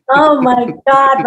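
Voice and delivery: loud, high, drawn-out mock horror; mortified, retold. Twelve-year-old reacting to drunk dad's rant, hammed-up mortification. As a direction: act out a child's embarrassed dismay. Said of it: "I was just mortified".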